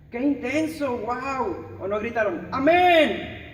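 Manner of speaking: loud mock-gushing praise from an over-eager congregant; mock excited shouting. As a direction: ham up a churchgoer gushing and yelling amen. Mocking over-enthused listeners.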